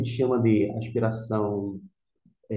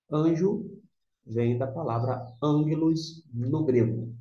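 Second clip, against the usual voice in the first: ordinary talk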